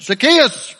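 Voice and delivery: loud, high shout of a name; calling out. Acted. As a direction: call him out over a noisy crowd. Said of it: "the Lord's voice calling his name out".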